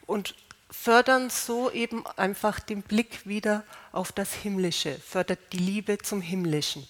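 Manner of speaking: normal talking voice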